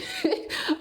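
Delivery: giggle. Real, not acted. Excited about her new app.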